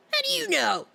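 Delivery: mock high-pitched outcry. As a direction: squeal it in playful outrage. Repeats the question much higher, louder.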